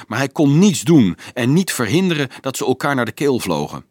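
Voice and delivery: plain reading voice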